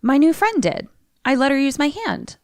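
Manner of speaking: put-on a creepy little girl's voice, lightly done; lightly voiced little girl. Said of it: "And Doris says".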